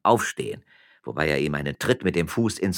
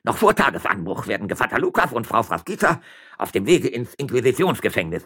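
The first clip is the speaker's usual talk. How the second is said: put-on high, gloating old man; raised, triumphant villain's voice; malicious glee; 5 semitones higher, 5 dB louder than usual. A narrator's reading. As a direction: scheme aloud with spiteful relish, then give brisk orders. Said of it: "mit boshaft glänzenden Augen".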